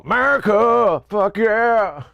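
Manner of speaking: loud, high cheer; patriotic cheer. Pretend. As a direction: belt it like a proud fan.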